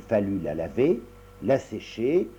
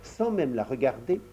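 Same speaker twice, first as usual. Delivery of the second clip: plain reading voice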